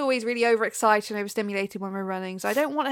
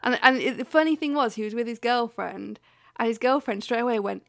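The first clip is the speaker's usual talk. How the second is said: ordinary talk, nothing marked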